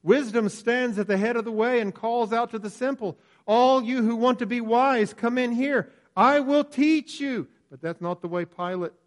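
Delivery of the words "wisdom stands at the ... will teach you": Wisdom calling out. Acted. Wisdom personified calling to the simple.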